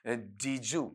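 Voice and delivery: "डीजू" slowly, did and you fused into one word; slow connected diju. Shown as a demonstration. Teacher slowly showing the blended form. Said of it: "ये नजदीक कौन सा".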